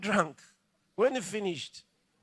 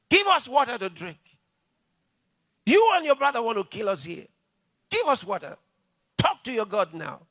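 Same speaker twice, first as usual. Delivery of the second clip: loud, high demanding shout; shouting crowd; 5 semitones higher, 8 dB louder than usual. Retold or imitated. The thirsty crowd shouting at Moses, loud and high. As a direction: yell it like an angry mob. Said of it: "shouted at him".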